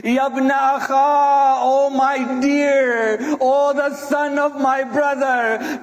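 long, drawn-out wailing cries; anguished wail with stretched vowels; screamed lament. Acted. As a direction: cry out slowly over the fallen.

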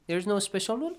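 ordinary talk, nothing marked

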